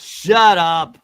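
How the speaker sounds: a sharp, loud order; loud snap. Acted. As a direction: shut him down, loud. Snapping at teammate in comic reading.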